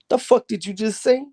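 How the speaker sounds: high-pitched mock disbelief; incredulous. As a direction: imitate a guard who can't believe his ears. Cop reacts in disbelief to inmate.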